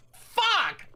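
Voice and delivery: high, sharp cry of dismay; shocked curse. Retold or imitated. Robber realizing the skater pulled a phone, high shocked curse. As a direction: blurt it out in a jolt of horror.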